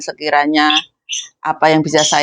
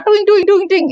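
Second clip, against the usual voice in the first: very high bouncy sing-song; cheerful self-pep; 14 semitones higher than usual. Shown as a demonstration. Maid cheering herself up in the morning, very high and bouncy. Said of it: "untuk menyemangati diri sendiri".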